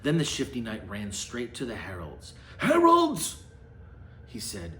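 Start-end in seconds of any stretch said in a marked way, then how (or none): 2.6-3.4 much higher, loud summoning cry; calling out. Acted. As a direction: call the heralds across the hall. Knight calls the heralds.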